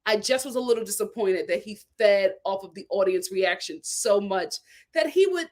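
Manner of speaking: ordinary delivery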